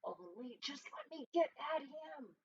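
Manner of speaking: soft, seething under the breath; angry mutter. Acted. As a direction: mutter through grinding teeth. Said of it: "muttered Toad".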